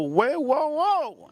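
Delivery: high, drawn-out mock whoa; alarmed protest. Refusing his girlfriend's fish, high alarmed protest. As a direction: pitch way up to halt her.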